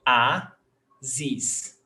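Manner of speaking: "O", "A", "zis" as three separate beats; syllable by syllable. Shown as a demonstration. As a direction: break "O, A, zis" apart with pauses. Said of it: "we've got three syllables".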